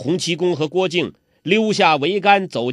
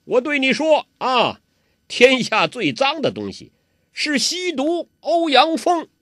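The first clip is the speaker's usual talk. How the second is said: put-on higher, raised voice of the old beggar; loud declaration; 10 semitones higher than usual. 洪七公大声告诉郭靖的答案. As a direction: proclaim it loudly. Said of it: "大声地说".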